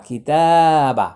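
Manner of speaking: slow chanted tilawat; Quran recitation. Shown as a demonstration. Teacher reciting the word, slowly chanted.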